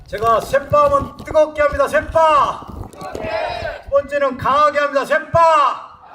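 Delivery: rallying shout. Real, not acted. Singer teaching the audience a call-and-response, rallying shout. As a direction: fire up the crowd to shout back.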